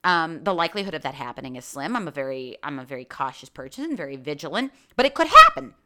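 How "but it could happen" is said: emphatic burst. Real, not acted. Sudden emphasis.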